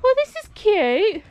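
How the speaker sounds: cooing at the dog at the lookout; high pet voice. Real, not acted. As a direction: coo at the dog. Talking to the dog.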